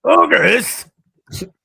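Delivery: high, loud hammed-up yell; mock ogre shout. Echoing his friend's ogre outburst, mock shout. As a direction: bellow ogres like a playful monster. Shouting ogres back jokingly.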